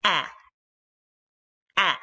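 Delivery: a clipped open a vowel; the Arabic fatha vowel "a"; short a sound. Shown as a demonstration. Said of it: "And it sounds like".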